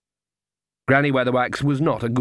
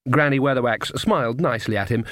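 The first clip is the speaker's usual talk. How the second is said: ordinary delivery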